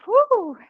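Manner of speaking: high, loud whoop of encouragement; cheering whoop. Real, not acted. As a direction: whoop to fire up the class. Cheering class through the set.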